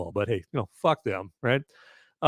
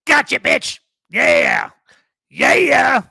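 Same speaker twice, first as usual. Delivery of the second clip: loud, high triumphant shout; imagined John Wick yell; 13 semitones higher, 8 dB louder, much slower than usual. Acted. Acting out what John Wick should have said, triumphant high shout. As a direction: whoop a victory taunt at full voice. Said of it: "I wish John Wick would have said".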